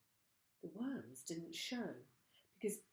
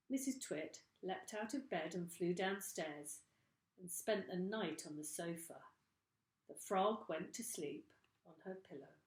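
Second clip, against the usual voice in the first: plain reading voice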